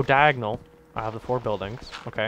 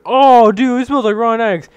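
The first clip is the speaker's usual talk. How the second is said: disgusted; 7 semitones higher, 9 dB louder than usual. Retold or imitated. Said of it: "They're like".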